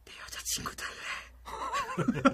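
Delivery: breathy high whisper into her ear; cupped hand whisper. Shown as a demonstration. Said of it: "어떻게요?".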